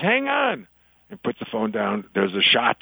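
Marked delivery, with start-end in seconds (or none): 0.0-0.7 high-pitched mock-frantic friend; comic panicky caller, voice pitched way up; acted fluster, high in the voice; panicked caller. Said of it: "the friend panics".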